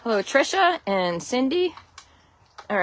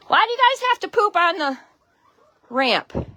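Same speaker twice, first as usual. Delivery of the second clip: high, exasperated scolding of the hens; a high, mock-fed-up voice for the birds; 11 semitones higher, 3 dB louder than usual. Real, not acted. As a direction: tell the chickens off in a high voice. Scolding her chickens on the ramp.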